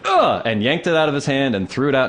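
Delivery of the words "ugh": effort grunt. Retold or imitated. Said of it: "So I just went".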